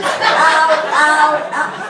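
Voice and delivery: loud, high mock yelps of pain; yelping in pain. Comedian acting out plucking long whiskers, yelping in pain. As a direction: cry ow at each painful tug.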